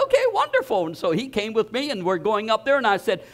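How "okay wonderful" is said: much higher, bright delighted okay wonderful; delighted surprise. Retold or imitated. High delighted surprise. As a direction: pastor replays his happy answer to Pete.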